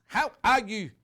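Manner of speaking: loud, high put-on Northern Irish lilt; Northern Irish accent. Shown as a demonstration. Presenter demonstrating his accent, exaggerated Northern Irish. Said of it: "That's my Northern Irish accent".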